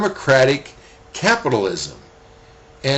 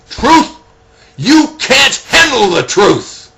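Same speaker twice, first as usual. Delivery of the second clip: much higher, much louder snarling outburst at the colonel; Jack Nicholson snarl; 12 semitones higher, 9 dB louder, slower than usual. Retold or imitated. Colonel snarls at the young lawyer in court, in a loud furious snarl. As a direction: bark it out slowly, furious and scornful. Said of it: "I can't even snarl like Nicholson".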